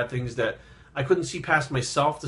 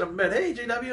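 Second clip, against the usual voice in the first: high-pitched, retold grandmotherly hello; cheerful greeting; 10 semitones higher than usual. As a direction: call out a greeting, imitating Grandma. Grandmother's cheerful greeting from her sickbed.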